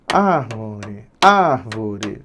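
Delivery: low, soft, drawn-out syllables; quiet tapping-out. Shown as a demonstration. Teacher showing the pen-tapping method, quietly sounding it out. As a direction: say it under the voice, feeling each beat. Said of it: "era batendo a canetinha".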